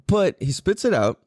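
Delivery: normal talking voice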